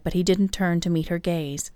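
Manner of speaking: ordinary delivery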